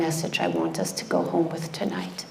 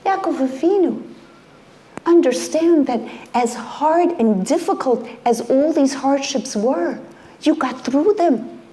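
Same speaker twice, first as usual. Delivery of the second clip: preaching as God; 8 semitones higher, 5 dB louder than usual. Acted. God tells Yaakov he got through, in fervent raised preaching. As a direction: voice of God, higher and louder, pressing the words.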